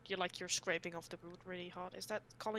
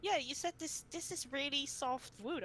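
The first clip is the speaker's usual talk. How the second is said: girl's high mocking voice; 6 semitones higher than usual. Classmate quoting her.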